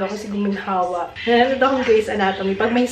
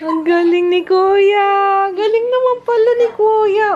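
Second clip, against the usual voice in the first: excited praise; 9 semitones higher, 8 dB louder than usual. Real, not acted. Mother cheers her toddler for naming colors, delighted praise.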